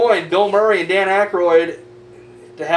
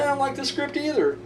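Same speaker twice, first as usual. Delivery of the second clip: higher, softer mock objection; Bill Murray; 6 semitones higher, 8 dB quieter than usual. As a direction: imitate a reluctant actor's small complaining voice. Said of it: "and Bill Murray's like".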